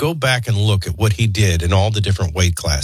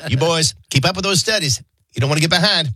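higher put-on role-model advice; playful impression of a neighbor giving counsel; imitating Kenny; 6 semitones higher than usual. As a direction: lecture the boys in a mock mentor voice. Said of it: "giving us the role model treatment".

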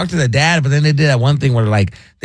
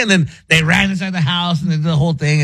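usual speaking voice

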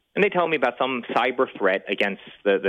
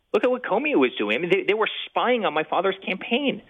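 indignant outburst; 7 semitones higher than usual. Real, not acted. Outraged about spying on campaign.

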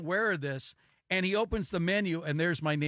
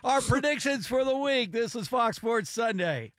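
announcer voice; 4 semitones higher than usual. Acted. Show sign-off announcement.

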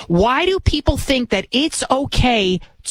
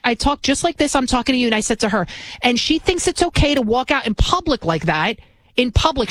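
usual speaking voice

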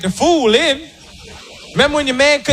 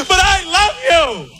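high slow plea; 8 semitones higher, slower than usual. Retold or imitated. Woman pleading.

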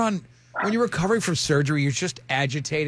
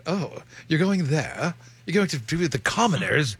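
snooty mockery. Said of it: "they're like".

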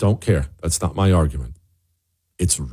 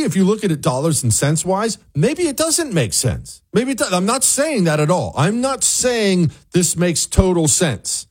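ordinary talk, nothing marked

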